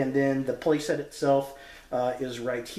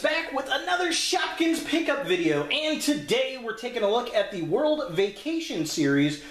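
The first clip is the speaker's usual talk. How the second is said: video intro announcer; 8 semitones higher than usual. Acted. Host greets YouTube with a new pickup video, high announcer voice.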